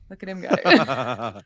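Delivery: deep slow voice. Pretend. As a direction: drop low and slow it way down. Repeats the line in a put-on voice.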